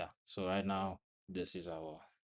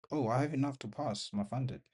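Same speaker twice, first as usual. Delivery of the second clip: surprised; 6 semitones higher, 5 dB louder than usual. Real, not acted. Realizes account passes.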